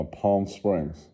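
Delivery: ordinary delivery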